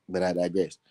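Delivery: usual speaking voice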